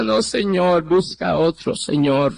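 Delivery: retold tearful plea. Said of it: "Y yo llorando le decía". (súplica llorosa, en tono bajo)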